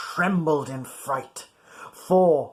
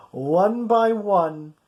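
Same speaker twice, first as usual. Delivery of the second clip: ordinary delivery, nothing marked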